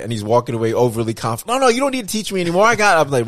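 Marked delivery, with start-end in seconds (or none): 1.6-3.0 overconfident. Retold or imitated. Overconfident father-in-law voiced.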